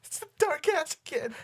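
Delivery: high, loud, hammed-up fright; mock terror. Friend pretending to panic at Dark Ass. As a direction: squeal it like a scared kid.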